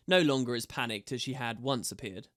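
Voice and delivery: usual speaking voice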